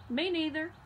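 dog agreeing with the farmer; talking dog. A narrator's reading. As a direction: chime in as the dog. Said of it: "said the dog".

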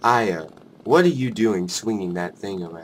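low, unbothered script read; monotone. Acted. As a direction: read the angry line with no anger at all. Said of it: "I can't deal with your monotone".

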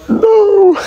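high-pitched drawn-out "no"; mock wail. Streamer joking about two thousand people at airport, mock dismay. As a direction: squeal it in pretend dismay.

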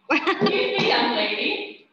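high, loud playful telling-off; mock scolding. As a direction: scold the dog with a grin. Scolding the dog mid-trick.

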